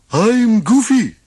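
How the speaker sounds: high, slow, goofy drawl; slow goofy shopkeeper voice. A narrator's reading. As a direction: play the dopey cheerful guide. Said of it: "the shopkeeper said".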